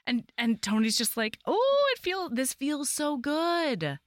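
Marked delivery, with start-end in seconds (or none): 1.5-4.0 high, slow swooning sigh; blissful. Retold or imitated. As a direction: melt with pure bliss. Tony blissful in collaboration.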